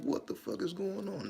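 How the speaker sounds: high-pitched retold panic; frightened. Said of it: "I'm afraid out of my mind".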